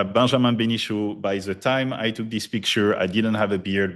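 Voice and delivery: ordinary talk, nothing marked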